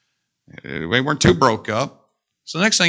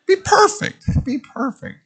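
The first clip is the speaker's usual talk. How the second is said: alarm sing-song; 13 semitones higher than usual. Pretend. Pretend alarm wake-up.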